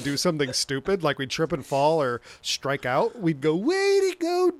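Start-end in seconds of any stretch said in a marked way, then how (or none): 3.5-4.5 put-on high, loud taunting kid voice; mock a friend who just tripped; Melvin voice. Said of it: "doing the Melvin voice to mock one another".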